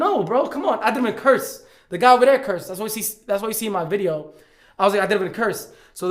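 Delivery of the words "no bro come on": high, swooping objection; retold pleading protest. Passenger refusing a two-hour wait, high mock protest. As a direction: imitate an exasperated plea.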